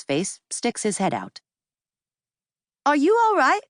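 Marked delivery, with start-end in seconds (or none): 2.8-3.6 put-on much higher, louder, concerned English accent; a polite young Englishman checking on her; melodic English accent. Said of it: "he asks in that same melodic English accent".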